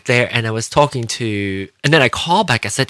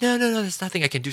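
agent brushoff; 9 semitones higher, 6 dB quieter, much faster than usual. Retold or imitated. Agent refusing, imitated.

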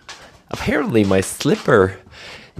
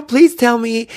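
higher, louder reporter voice, less extreme; milder mock reporter; 14 semitones higher, 9 dB louder than usual. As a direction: lighter reporter voice. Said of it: "I can go out there and say".

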